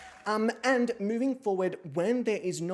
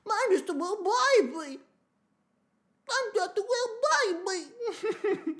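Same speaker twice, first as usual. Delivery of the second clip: put-on very high, drawn-out baby voice; exaggerated baby talk, pitched way up; baby impersonation; slower than usual. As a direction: squeal it slowly like a creepy baby. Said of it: "renowned baby impersonator".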